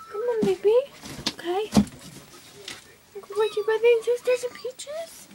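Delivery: high loud coaxing pet talk. Real, not acted. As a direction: coax the kitten back. Coaxing cat.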